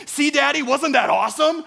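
put-on thrilled kid calling to Daddy; excited young son. Four-year-old son to his daddy after braking, excited. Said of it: "and goes".

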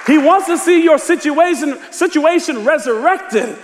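high, urgent sermon delivery; full-force preaching. Acted. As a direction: preach it out to the whole congregation.

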